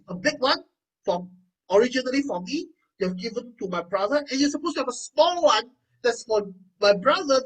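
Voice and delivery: acted son's exasperated complaint; indignant protest. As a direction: argue that you've been cheated. Esau argues with his father.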